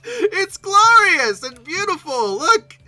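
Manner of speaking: high laughing delight, slow; laughing with joy, words stretched out. Real, not acted. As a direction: gush over them through a laugh. Delighted by pterosaur images.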